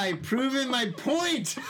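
slow, triumphant gloat. Real, not acted. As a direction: savor the victory. Gloating after the prank.

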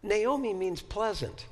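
ordinary talk, nothing marked

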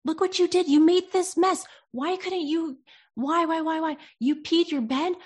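high, loud, accusing scold; scolding parent. Acted. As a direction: lay into a child over a mess. Voicing a scolding parent to child.

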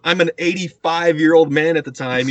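usual speaking voice

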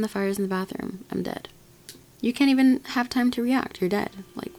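normal talking voice

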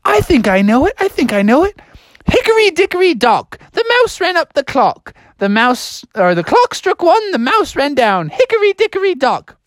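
put-on high, excited child-like voice; eager pupil. Pupil recites a rhyme for teacher, high eager voice. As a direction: pipe up, then recite brightly in falsetto. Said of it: "He's like".

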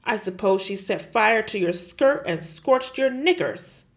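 loud, sneering headmistress; scornful snort. Acted. Headmistress mocking Miss Honey with a loud sneer. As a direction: scoff at the teacher. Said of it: "Miss Trunchbull snorted".

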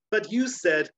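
high, loud protest; protesting girl. Acted. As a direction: object sharply up high. Sarah protests.

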